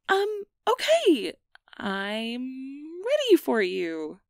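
a flustered, eager invitation; nervous excitement. Acted. Nervous welcome to the guest.

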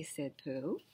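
ordinary delivery

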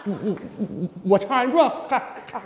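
stuttering, low and halting; repeated false starts of a stutterer; struggling to speak. Acted. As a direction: stammer on the first word of each phrase. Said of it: "你这说话都费劲呢".

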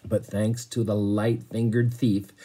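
plain reading voice

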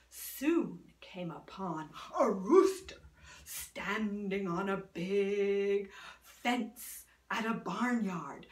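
ordinary delivery, nothing marked

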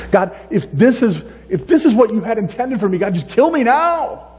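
rising from fed-up to high, despairing plea; desperate Moses. Retold or imitated. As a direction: build from weary grumbling to a cracked-high cry. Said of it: "That's what Moses says".

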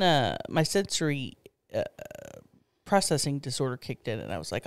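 ordinary talk, nothing marked

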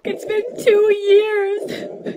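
put-on high child's voice building to a wail; tearful child. Six-year-old asks Mommy for absent Daddy, tearful. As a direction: whine it, then stretch into a loud cry.

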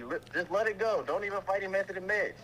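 ordinary talk

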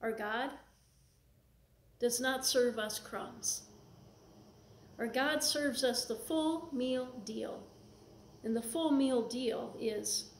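ordinary delivery

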